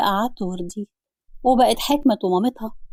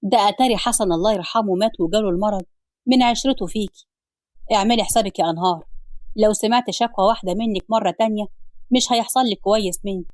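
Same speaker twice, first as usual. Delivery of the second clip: ordinary delivery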